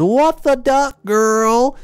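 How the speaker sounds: high-pitched startled mock yell; outraged cry, not at full volume. A narrator's reading. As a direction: yell it high, drawing out the last word. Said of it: "jumped out of the way and yelled".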